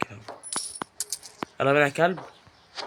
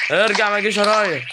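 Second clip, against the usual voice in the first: loud shout; 5 semitones higher, 5 dB louder than usual. Real, not acted. Yelling at dog following him.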